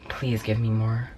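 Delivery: low, slow, soft begging; groggy little plea; mild pleading. Retold or imitated. Acting a drugged plea for drugs.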